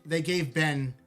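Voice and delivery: ordinary talk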